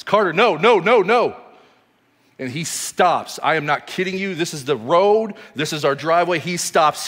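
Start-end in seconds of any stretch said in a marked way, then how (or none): 0.0-1.4 loud, high, repeated yelled no's; acted-out top-of-lungs warning; reenacted panicked yell. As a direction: yell to stop a child running into danger. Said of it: "I am yelling at the top of my lungs".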